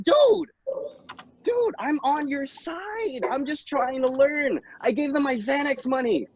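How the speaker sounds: pleading in disbelief; exasperated plea. Acted. Exasperated high protest.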